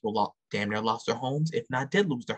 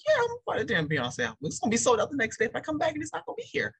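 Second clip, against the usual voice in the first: eccitato. Acted. Acted fan reaction to the album.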